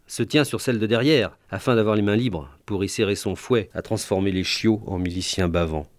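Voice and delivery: usual speaking voice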